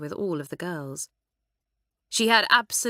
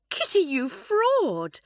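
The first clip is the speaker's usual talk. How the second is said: higher, slow astonished cry; a schoolgirl exclaims in amazement at her classmate, higher and slow; amazed exclamation; 9 semitones higher, 3 dB louder, slower than usual. Acted. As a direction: catch her out in delighted disbelief. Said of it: "exclaimed Amanda".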